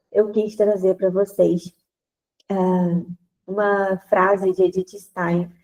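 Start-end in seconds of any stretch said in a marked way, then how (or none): none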